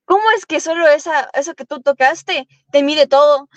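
normal talking voice

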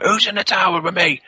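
high, insistent mock-alarmed question; agitated demand. Friend Tim presses the campers about the tower, agitated demand. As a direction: act out a spooked friend pressing for answers.

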